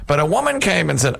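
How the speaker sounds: ordinary talk, nothing marked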